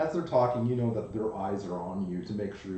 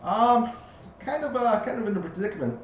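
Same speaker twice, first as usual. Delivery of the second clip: high, loud tiny bug voice; pixie; 10 semitones higher, 7 dB louder than usual. Acted. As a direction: shout up from the ground like a tiny ladybug. GM voices the ladybug.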